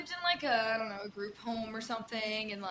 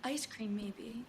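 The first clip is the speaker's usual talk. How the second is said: hushed patient; much slower than usual. Retold or imitated. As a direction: answer in a hushed small voice. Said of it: "She's like".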